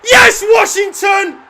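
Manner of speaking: high, full-volume celebration; ecstatic goal shout. Real, not acted. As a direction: yell him on, then roar with joy.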